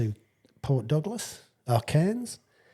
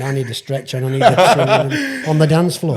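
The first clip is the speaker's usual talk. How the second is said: louder, cracking up; laughing punchline; 5 semitones higher, 10 dB louder than usual. Real, not acted. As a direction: deliver it while laughing.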